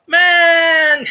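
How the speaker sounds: loud, high, hammed-up "Man."; a player, annoyed at not being recognized, shouts; mock exasperated shout. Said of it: "He goes".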